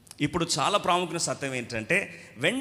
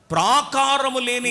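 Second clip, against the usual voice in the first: reading scripture; 7 semitones higher, 7 dB louder, slower than usual. Shown as a demonstration. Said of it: "చదవండి".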